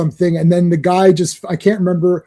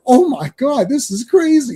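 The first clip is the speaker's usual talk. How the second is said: higher-pitched mock astonishment; retold amazement; 7 semitones higher than usual. Podcaster retelling his reaction to the video, amazed. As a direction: relive being blown away, pitch jumping up.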